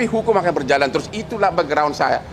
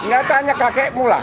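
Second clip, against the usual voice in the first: higher, irritated dismissal; annoyed retort; 5 semitones higher than usual. Real, not acted. Lawyer dismissing a pushy reporter's question, irritated and higher. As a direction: snap it back at the reporter.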